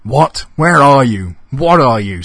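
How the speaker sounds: loud, high, startled questions; alarmed questioning. A narrator's reading. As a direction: demand answers in alarm. Startled boy questions an unseen voice.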